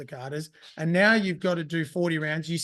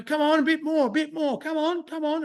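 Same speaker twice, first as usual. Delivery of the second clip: high, urging come on; imitating coaches cheering; 9 semitones higher than usual. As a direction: egg the fighter on like a corner coach. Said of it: "Kyoshi and Billy were like".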